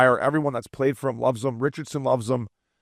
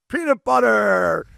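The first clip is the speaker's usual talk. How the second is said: mock bench yell; 8 semitones higher, much slower than usual. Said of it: "just yelling on the back of the bench".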